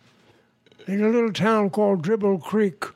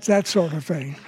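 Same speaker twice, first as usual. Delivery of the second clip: ordinary talk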